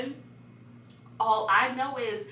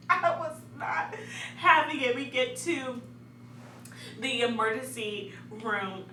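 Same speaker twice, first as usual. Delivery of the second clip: ordinary talk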